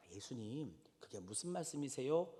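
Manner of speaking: meek, courteous query to Jesus; polite quiet question. Retold or imitated. Disciple gently questioning his teacher, soft and polite. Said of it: "한 게 아니라".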